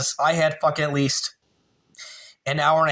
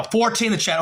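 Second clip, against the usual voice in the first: sudden high-pitched burst; real excitement; 8 semitones higher than usual. Real, not acted. Streamer spotting fourteen in the chat, excited. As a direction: break off to announce a new record. Viewer count climbs to fourteen.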